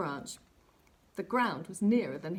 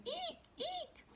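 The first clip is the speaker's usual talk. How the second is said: very high, tiny squeaky eek; owl screech; 9 dB quieter than usual. Acted. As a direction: squeak like an excited owlet.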